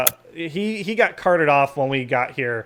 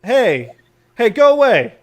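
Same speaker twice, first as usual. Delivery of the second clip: loud, higher, sharp order to leave; shouting passerby; 8 semitones higher, 8 dB louder than usual. Acted. Passerby butts in on talk with Gordy, shouting. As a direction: shout someone off. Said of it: "another guy says".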